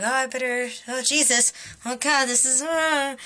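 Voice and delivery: understated bother, far short of panic; not freaking out. Retold or imitated. Bitten man trying to get crocodile off, mildly bothered. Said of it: "No, he's just".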